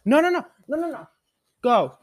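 high-pitched urgent no no no; high scolding no. Real, not acted. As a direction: scold the dog, high and quick. Scolding the dog.

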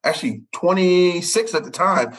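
ordinary delivery

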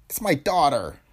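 high character voice, upset; distraught father. A narrator's reading. Father explaining himself to Tony, distraught. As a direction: confess it high and troubled. Distraught Greg confesses to Quaid.

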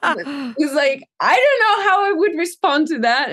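words bubbling with real laughter, high and loud; laughing. Real, not acted. Singer mocking old vocal lessons, laughing. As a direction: laugh your way through it.

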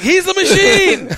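high, loud, slow crowing; gangsters losing it. Retold or imitated. Gangsters hailing their new friend, wildly delighted. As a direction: cheer it out, stretched and high. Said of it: "they start losing it".

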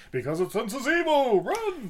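high, hammed-up warning to flee; high mock panic, sensing evil; mock alarm. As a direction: yell it like a cheesy horror prophet. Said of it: "I'm like".

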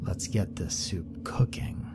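whispered aside; said to himself. A narrator's reading. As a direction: mutter it under your breath. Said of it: "more to himself".

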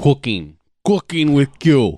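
put-on over-the-top New Jersey accent; bad Jersey accent. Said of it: "I just do the worst Jersey accent I could do".

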